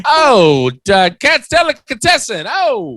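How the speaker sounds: high, drawn-out Brooklyn caricature; hammed-up Brooklyn holler; old Brooklyn accent. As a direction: crow it like a mock Brooklynite.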